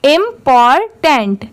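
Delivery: Important slowly as "im-por-tent"; slow, separated syllables in important; syllable-by-syllable model. Shown as a demonstration. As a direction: break important into three parts. Teacher joins the syllables slowly.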